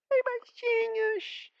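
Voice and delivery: put-on very high, slow, squeaky kids' host voice; thin squeaky voice. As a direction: squeak it out like a cartoon mouse. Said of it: "o Mickey junto falando fininho".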